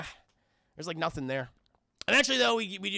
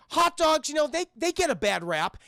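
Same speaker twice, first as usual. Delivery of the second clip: hot dog guy; 7 semitones higher, 3 dB louder than usual. Retold or imitated. Nursery guy pitches hot dogs.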